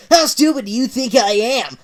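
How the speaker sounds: loud angry accusation; accusing Sonic. Acted.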